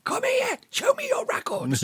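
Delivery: very high squeaky mock shout; mock-frantic high-pitched plea; comic desperate demand. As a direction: chase them down in a silly falsetto. Mock chasing customers round Hanley.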